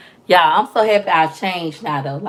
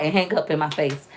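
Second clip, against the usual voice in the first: ordinary delivery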